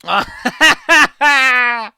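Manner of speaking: loud, high cackling belly laugh; helpless high laughing fit; big burst of laughter. Real, not acted. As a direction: crack up hard and loud. Cracks up at a joke.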